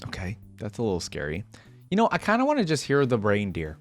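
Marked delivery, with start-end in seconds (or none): none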